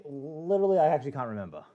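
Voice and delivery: usual speaking voice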